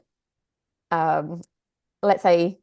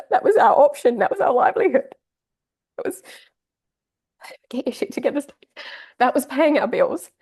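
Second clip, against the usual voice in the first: pushing words out through real tears; still crying; 4 semitones higher than usual. Real, not acted. Still tearful, telling viewers the business paid the bills. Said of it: "I'm gonna get emotional".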